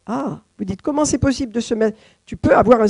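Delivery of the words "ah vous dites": ordinary talk, nothing marked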